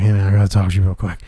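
low, soft and fast; hushed urgent. Retold or imitated. To his dad watching the game, hushed and hurried. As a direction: rush it quietly under the breath.

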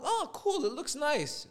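high-pitched mock delight; naive approval. Homeowner first seeing the paint job, naively pleased.

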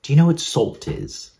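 put-on high, blunt British chef accent; Gordon Ramsay impression. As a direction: snap it like a British TV chef scolding a cook. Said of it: "says in his British accent".

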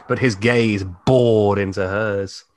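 usual speaking voice

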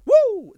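high, loud wrestling whoop; Ric Flair woo. Retold or imitated. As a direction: let out a big showman's woo like a pro wrestler. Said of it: "the Ric Flair".

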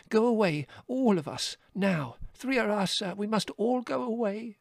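high, insistent little rabbit; urgent plea. A narrator's reading. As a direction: plead far up in pitch, pressing every phrase. Fiver urges everyone to flee.

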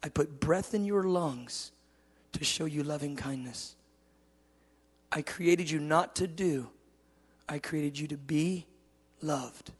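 soft, slow, with long pauses; God's tender declaration. Retold or imitated. God telling his son he is loved, tender and slow. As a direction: speak love gently, letting phrases fade. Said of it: "He said".

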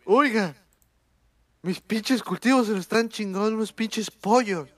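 lightly voiced old boomer farmer; a hint of a grumpy old man. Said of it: "la pinche voz de viejo".

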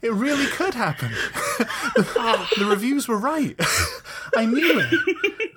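high squeaky laughing words; giggling hysterically. Real, not acted. Host cracking up as the bit escalates, laughing. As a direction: giggle through each line.

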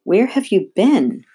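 slow, emphatic storybook outcry; exclaiming. A narrator's reading. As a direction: demand an explanation, stretching the words.

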